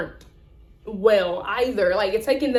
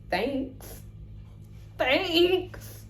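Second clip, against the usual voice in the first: sarcastic thanks; 5 semitones higher, 7 dB quieter than usual. Retold or imitated. Wry reply to bad engine news.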